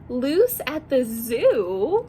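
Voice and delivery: ordinary delivery, nothing marked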